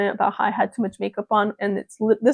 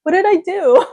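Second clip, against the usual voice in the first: high, louder baffled plea; baffled question; 8 semitones higher, 5 dB louder than usual. Retold or imitated. Vlogger retelling her confused reply to coldness. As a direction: ask it truly puzzled. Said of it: "I'm like".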